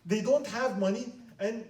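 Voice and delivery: high, loud and outraged; indignant advisor. Acted. Advisor denounces the Patriarch.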